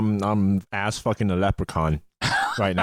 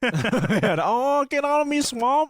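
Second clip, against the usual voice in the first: very high, squealed mock Scottish accent; hammed-up ogre accent, pitched way up; Shrek; slower than usual. Joking ogre catchphrase for friends, squealed mock Scottish accent. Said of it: "copying Shrek's accent".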